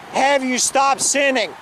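ordinary talk

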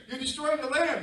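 high, loud pleading warning; dog's warning. Acted. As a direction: push the warning up and out. Dog scolding humans.

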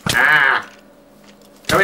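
sharp yell after the skeleton. Real, not acted. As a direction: call it out, loud and annoyed. Yelling at skeleton.

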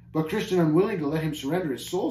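ordinary delivery

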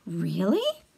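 usual speaking voice

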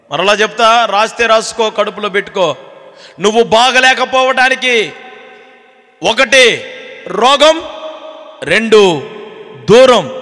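loud, high fiery sermon delivery; full-force preaching. Acted. Preacher booming at the congregation to shout hallelujah. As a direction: rouse the crowd, hammering every point.

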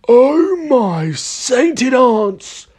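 high, very drawn-out exclamation; astonished cry. A narrator's reading. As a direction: stretch it out in high-pitched dismay. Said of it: "cried Mister Killycranky".

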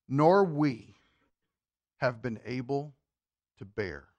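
low, slow, sinking to a hush; grave scripture reading. Acted. Preacher reading Peter's rebuke to church, grave and hushed.

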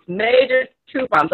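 ordinary delivery

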